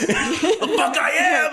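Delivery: high, drawn-out yell of defiance; defiant yell. Acted. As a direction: yell back at the monster's taunt. Player defies the monster's taunt.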